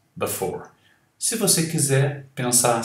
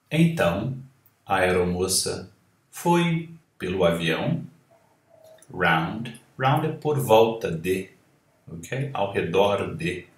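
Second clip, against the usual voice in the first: normal talking voice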